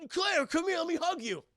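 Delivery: high, put-on greeting voice; delighted brother. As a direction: call out eagerly for a hug. Acts Chris greeting his sister.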